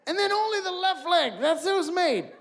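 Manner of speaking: excited rant. Real, not acted. Continues the snowsuit rant.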